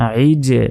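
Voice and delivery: আইজে with the final j pushed out into an echo; qalqalah bounce. Shown as a demonstration. Teacher shows students qalqalah, আইজে bouncing off the j. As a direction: release the j of আইজে with a small bounce. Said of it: "এটাই কলকলা".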